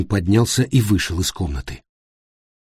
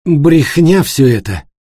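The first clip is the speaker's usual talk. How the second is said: slow, loud, slightly raised protest; forced-out denial; 5 semitones higher, 6 dB louder, slower than usual. A narrator's reading. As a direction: push the words out with effort at last. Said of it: "выдавил, наконец". (выдавить из себя громко и медленно)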